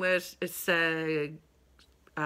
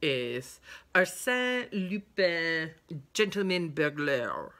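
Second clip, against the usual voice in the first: slow, drawn-out French accent on the title; slower than usual. Shown as a demonstration. Book reviewer showing off her French accent on the title. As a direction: say the title like a Parisian, savouring each word. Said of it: "My French accent".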